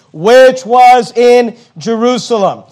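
"which was in Jerusalem" drawn out word by word; slow right down and punch "which was in Jerusalem"; slow emphasis. Real, not acted. Preacher pointing out the emphasis in the verse, slow and emphatic. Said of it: "But notice the emphasis".

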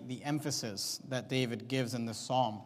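ordinary talk, nothing marked

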